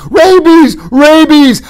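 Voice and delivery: very high, loud panicked cry; frightened full-force scream; Fezzik crying out. A narrator's reading. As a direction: shriek it twice in terror. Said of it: "before Fezzik cried".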